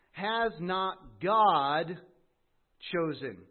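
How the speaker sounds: Has not God, stretched very slowly, pause before chosen; dramatic slow question. Shown as a demonstration. Preacher building to James's question, very slow with a pause. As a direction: hang on "God", then drop "chosen". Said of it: "The emphasis here is on God's choosing".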